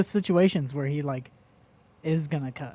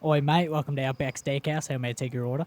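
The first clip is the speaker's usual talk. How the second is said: lightly done Australian accent, a bit softer; a half-hearted Aussie waiter greeting; bad Australian accent; 4 semitones lower, 6 dB quieter than usual. Shown as a demonstration. Asked to show it, he tries a weak Australian accent. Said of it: "I can only do Australian".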